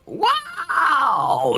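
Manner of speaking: mock amazement. Real, not acted. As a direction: gasp it out in exaggerated awe. Mock awe at the reveal.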